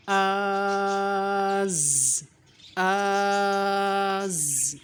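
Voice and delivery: very slowly held آز, the alif stretched long; long madd. Shown as a demonstration. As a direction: pull آز out on the madd. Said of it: "madd shown". (آز کو مد کے ساتھ خوب کھینچ کر)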